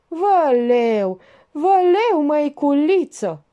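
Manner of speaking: high, loud peasant-woman wail; pained wail. A narrator's reading. Pregnant Georgeta clutches her belly at table, wailing high. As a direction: cry out in pain, clutching the belly.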